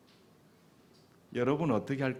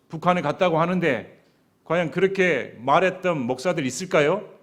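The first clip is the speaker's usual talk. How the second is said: loud raised preaching voice; fervent preaching; 5 semitones higher, 6 dB louder than usual. Real, not acted. Pastor challenging the congregation, loud fervent preaching. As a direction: press the congregation with full force.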